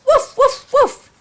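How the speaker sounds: high, loud yappy barks; the dog barking to show where the boy is hiding. A narrator's reading. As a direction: bark like an excited little dog. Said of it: "Splinter barked and he barked".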